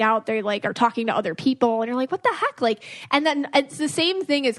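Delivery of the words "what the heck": high, incredulous what the heck; playful indignant squeak; mock disbelief. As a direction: pitch it way up in shock. Reacting to cheating partner.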